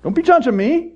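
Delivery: high, loud mock thanks; hammed-up gratitude. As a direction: gush with selfish relief. Mock self-serving joy.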